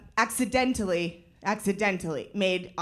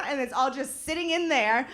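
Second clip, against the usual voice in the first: ordinary talk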